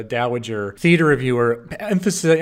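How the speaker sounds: usual speaking voice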